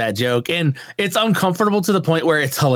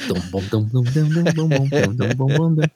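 scat a walking bass under the poem; imitated upright bass. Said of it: "upright bass playing behind you".

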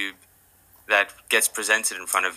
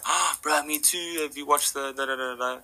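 excited fan; 6 semitones higher, 4 dB quieter than usual. Retold or imitated. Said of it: "they're like".

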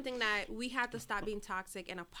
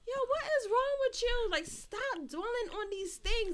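much higher, exasperated scold at herself, slow; self-scolding; 12 semitones higher, 3 dB louder than usual. Retold or imitated. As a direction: shake yourself out of it.

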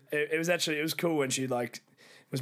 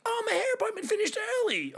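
much higher, airy put-on girly voice; imitating girlfriend. Teller mimicking fiancée Raz ringing him, high girly voice. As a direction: mimic her chirpy phone call. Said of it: "She's like".

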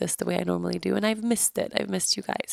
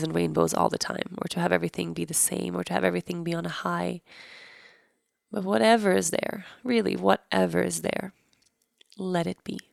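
guided meditation; 3 dB quieter than usual. Acted. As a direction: gently guide listeners inward.

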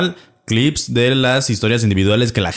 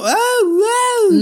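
mock amazed. Quoted surprise.

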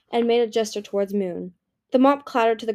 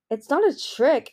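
protested; 5 semitones higher, 4 dB louder than usual. A narrator's reading. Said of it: "Anemone protested".

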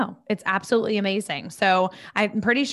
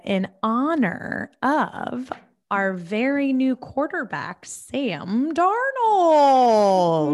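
proud announcement; 4 semitones higher, much slower than usual. Real, not acted. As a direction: announce the name like a stadium call. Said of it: "Nice. And the crowd goes wild".

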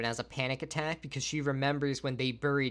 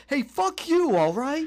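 much higher, loud Brooklyn outburst; Vinovich's dropped accent, Brooklyn; 13 semitones higher, 6 dB louder, slower than usual. Retold or imitated. Host mimics the magician breaking into an angry Brooklyn accent. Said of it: "breaks his accent".

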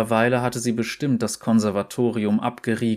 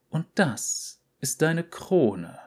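hoch und langsam, feierlich; Tercys Stimme; 6 semitones higher, slower than usual. Acted. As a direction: sprich wie eine strenge alte Dame. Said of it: "hatte Tercy zu ihr gesagt". (leicht gespielte alte Direktorin)

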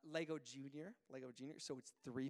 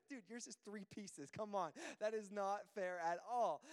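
mock-indignant dad, slow and higher; exasperated protest; 4 semitones higher, 4 dB louder than usual. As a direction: drag out the complaint, building louder. Acted protest to his son.

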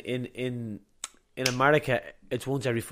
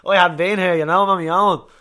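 louder, higher retold protest, half-laughing; exasperated protest; 7 semitones higher, 14 dB louder than usual. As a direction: push it out like he can't believe he's stranded alone. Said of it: "I'm like".

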